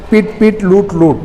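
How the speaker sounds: पिट short then long as पीट, लूट short then long; short and long vowel contrast. Shown as a demonstration. Teacher demonstrating where stress falls, short versus long vowels. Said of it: "बलाघात कैसे होगा".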